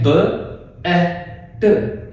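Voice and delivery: "ब" "ए" "ट" separated with pauses; sounding out. Shown as a demonstration. Teacher sounding out each letter of bet. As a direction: split "ब ए ट" into slow separate sounds. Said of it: "इसमें देखें".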